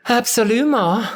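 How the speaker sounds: very high, rolled gushing Absolument; gushing French. Acted. Cassandra gushes in French at her reflection. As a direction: savour Absolument like something delicious. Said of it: "she gushed".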